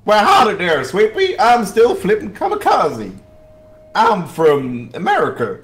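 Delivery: hammed-up American howdy; American accent. Doing an American accent for a friend in the chat, hammed-up. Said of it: "I need to do my best American voice".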